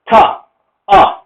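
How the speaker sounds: ta, then uh, the R dropped; isolated British "ta" and bare "uh". Shown as a demonstration. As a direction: sound out "ta, uh" as a British word ending. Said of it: "not going to bother pronouncing that letter".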